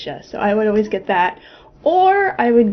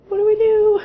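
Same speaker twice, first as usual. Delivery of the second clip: high, louder, half-crying panic; mock panicked cry; 12 semitones higher, 3 dB louder than usual. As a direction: push the fear up to a whimper. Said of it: "we're both just like".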